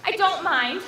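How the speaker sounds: usual speaking voice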